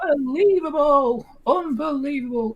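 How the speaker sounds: very high, stretched mock commentator yell of Unbelievable; Gary Neville impression. Imitating the pundit's famous goal call, very high and stretched. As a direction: squeal it like an overexcited pundit. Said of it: "Gary Neville's not been in the game".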